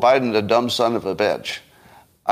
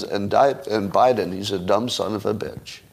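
matter-of-fact; 2 semitones lower than usual. Shown as a demonstration. Showing how Trump slips in the insult, matter-of-fact. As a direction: toss it off like any other statement. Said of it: "it's more matter-of-fact".

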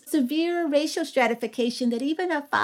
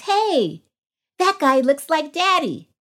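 a hint of an excited five-year-old, higher; lightly voiced little boy; 5 semitones higher than usual. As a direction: point and say it like an eager small boy. Mother voices her young son.

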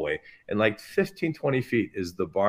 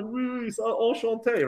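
high, dazed French reply; 12 semitones higher than usual. Retold or imitated. Dazed after the mirror, answering the chef, high. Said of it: "I'm like".

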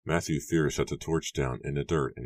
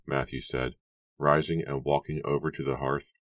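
plain reading voice

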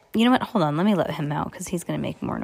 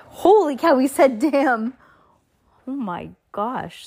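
shocked; 6 semitones higher than usual. Real, not acted. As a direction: blurt it out, caught off guard by the swear. Reader shocked by swear word.

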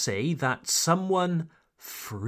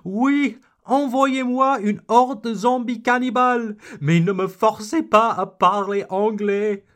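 high, fast, louder and frantic; panicked learner; 6 semitones higher, 5 dB louder, faster than usual. Pretend. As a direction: plead like a terrified learner. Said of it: "some people are more terrified".

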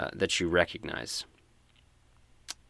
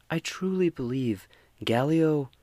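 higher, earnest fisherwoman voice; fisherwoman Valdi; 7 semitones higher than usual. Acted. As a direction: insist on trust, pitched up high. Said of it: "And Valdi says".

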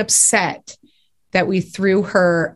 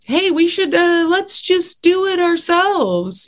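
high, drawn-out pitch to a friend; bright idea; 10 semitones higher, slower than usual. Retold or imitated. Two comedy partners deciding to write together, eager suggestion. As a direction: propose it high, stretching the last words. Acts out their shared decision.

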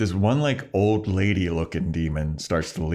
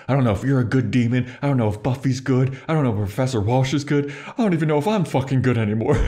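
higher, fast, building exasperation; mock frantic rant; 6 semitones higher, 3 dB louder, faster than usual. Riley at gunpoint standoff not knowing whom to believe, mock frantic rant. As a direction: rattle out the doubts, rising in pitch. Retold Riley's mounting confusion.

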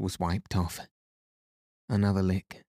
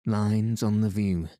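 usual speaking voice

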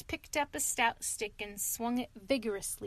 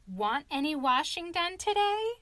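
put-on higher, sweet old washerwoman offer; 6 semitones higher, 4 dB louder than usual. Mole in disguise offering the sentries washing. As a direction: sell your washing to the guards.

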